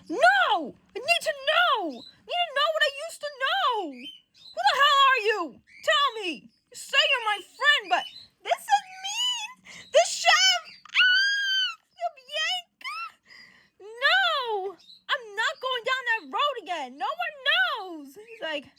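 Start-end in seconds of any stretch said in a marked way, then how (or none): 0.1-13.0 high, loud yelling climbing into a shriek; desperate outburst. Acted. Johnny demanding to know from his friend, frantic high yelling. 13.8-18.1 high, heated, drawn-out protest; firm refusal. Acted. Peter refusing to go down that road, high heated refusal.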